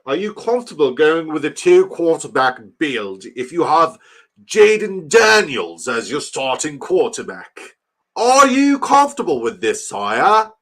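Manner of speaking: loud, high-pitched mock-English pomp; hammed-up English accent. Streamer asking his sire about quarterbacks, hammed-up English accent. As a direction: play an overdone English courtier, pitch soaring. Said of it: "I'm done with the English accent".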